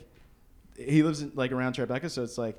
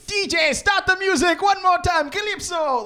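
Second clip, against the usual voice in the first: much higher, louder hype call to the DJ; mock Jamaican shout; 14 semitones higher, 8 dB louder than usual. Jamaican host shouting to the DJ, hyped mock call. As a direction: bellow it like a party MC. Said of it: "then he goes".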